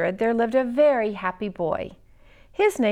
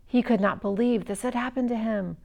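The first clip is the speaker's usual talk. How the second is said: ordinary delivery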